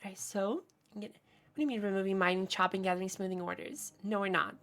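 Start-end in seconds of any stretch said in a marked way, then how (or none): none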